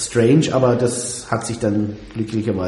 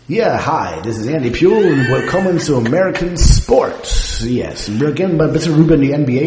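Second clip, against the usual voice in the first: put-on German read with a thick American accent; 3 semitones higher, 6 dB louder than usual. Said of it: "du sprichst es bitte mit einem amerikanischen Akzent".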